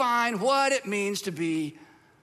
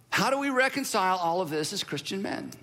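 normal talking voice

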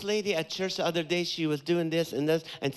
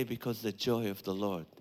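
calm confession; 9 semitones lower, 8 dB quieter than usual. Acted. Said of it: "You say".

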